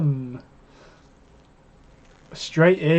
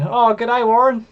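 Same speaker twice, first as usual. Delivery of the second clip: mock Aussie accent; 8 semitones higher, slower than usual. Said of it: "you could barely understand what they're saying".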